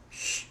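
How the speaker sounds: sharp shushing sound; quick mother's shush; hushing shh. Acted. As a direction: hush a nosy child. Mother pig hushes her piglet.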